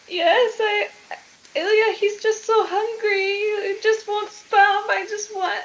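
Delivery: high-pitched, hammed-up weepy mother; tearful confession. Mother confessing for her hungry son, acted tearful. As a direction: confess in a high, pleading whimper. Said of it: "she starts crying".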